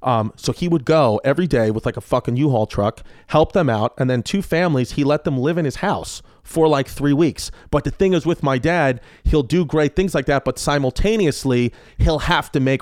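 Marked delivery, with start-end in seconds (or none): none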